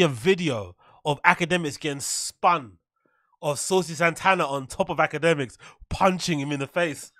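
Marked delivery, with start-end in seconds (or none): none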